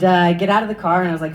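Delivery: ordinary talk, nothing marked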